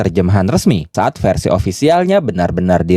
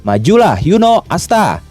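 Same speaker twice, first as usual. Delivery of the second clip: higher-pitched rallying call, only mildly shouted; mild narrated shout; 8 semitones higher than usual. A narrator's reading. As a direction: call them onward with a restrained cheer. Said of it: "semuanya berteriak bersamaan". (seruan penyemangat, sedikit berteriak)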